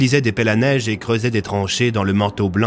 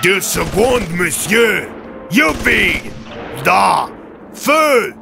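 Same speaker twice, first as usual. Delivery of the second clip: battle shouts; 10 semitones higher, much slower than usual. Acted. Soldiers shouting orders while firing the mortar. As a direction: bark fire orders over the guns.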